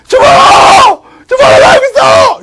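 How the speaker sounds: high, frantic full-voiced yell; shouting back. Prisoner answering his rescuers, shouting back. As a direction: holler desperately to be found.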